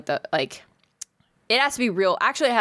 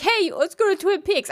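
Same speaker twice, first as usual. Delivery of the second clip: much higher, eager; high excited voice; 12 semitones higher than usual. Retold or imitated. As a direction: pitch it up, eager to convince. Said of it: "I'm like".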